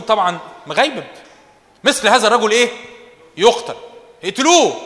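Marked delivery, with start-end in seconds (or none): 1.8-4.8 louder, higher furious king; hammed-up royal fury; angry royal verdict. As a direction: bark the death sentence like an enraged king. Angry king David sentences.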